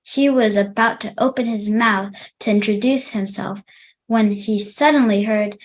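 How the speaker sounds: plain reading voice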